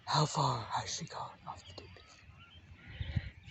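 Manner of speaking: high, slow, breathy whispered question; gossiping relative whisper. Pretend. Relatives asking, voiced.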